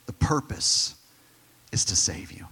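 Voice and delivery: whispered, hushed ending. Acted. Pastor whispers the takeaway to the church. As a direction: drop to a whisper on the promise.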